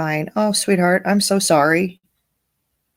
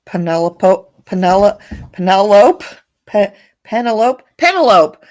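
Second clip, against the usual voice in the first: calling out; 3 semitones higher, 8 dB louder than usual. Pretend. Mock calling out a name.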